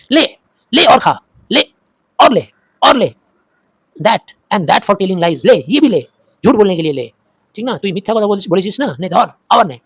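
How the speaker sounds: high, loud furious yells; furious beating. Acted. Fatik pounds his lying brother, high furious yells. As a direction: hurl each word like a blow.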